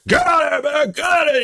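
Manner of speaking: high, forceful shout to scare off a bear. Shown as a demonstration. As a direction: demonstrate yelling a bear away. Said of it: "You gotta shout it".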